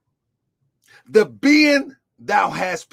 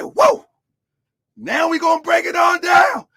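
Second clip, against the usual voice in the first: imitating the congregation; 7 semitones higher than usual. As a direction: mimic a listener exclaiming. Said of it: "You probably said".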